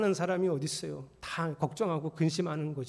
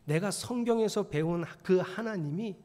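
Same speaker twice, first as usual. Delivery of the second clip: ordinary talk, nothing marked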